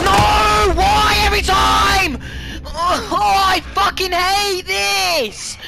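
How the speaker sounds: high, shouted wail of frustration; anguished rage. Real, not acted. Gamer killed yet again, anguished shout. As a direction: cry out, stretching the last words.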